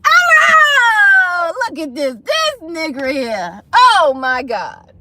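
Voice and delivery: loud high wail sliding down; hammed-up sobbing disbelief; mock wailing. Mock wailing after ex leaves.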